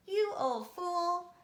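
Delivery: high, loud, slow scolding; scolding giantess. Acted. Giantess scolding her husband, high slow voice. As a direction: scold him like an old fool.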